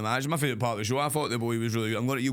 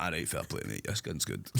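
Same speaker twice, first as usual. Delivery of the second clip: much softer, dry and resigned; quiet deadpan giving-in; muttered resignation; 9 semitones lower, 9 dB quieter than usual. Retold or imitated. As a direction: give in under your breath. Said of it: "So I'm like".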